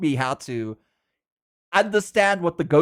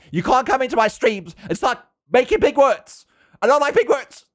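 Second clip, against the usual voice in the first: high, loud playful protest; hammed-up complaint, higher and louder; mock indignant; 10 semitones higher, 6 dB louder than usual. Streamer scolds chat for making big words, mock indignant.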